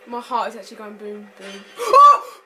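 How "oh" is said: sharp gasp into a loud, high yelp; startled gasp. Real, not acted. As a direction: jump in fright with a sudden cry. Startled in horror game.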